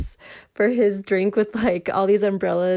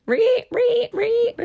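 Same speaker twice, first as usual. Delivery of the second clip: high-pitched imitated stabbing shrieks; Psycho stabbing screech; slower than usual. Fan mimicking the movie's stabbing scene, high Psycho screech. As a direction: screech like the Psycho shower strings. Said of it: "Psycho call out, right?".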